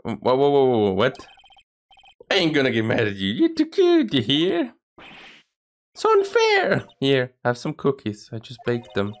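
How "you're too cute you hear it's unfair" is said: high, slow, airy lady voice; high sugary woman's voice, slowed down; eerie high lady. Acted. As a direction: draw it out sweetly and creepily. Violetta high cooing.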